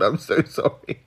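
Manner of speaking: usual speaking voice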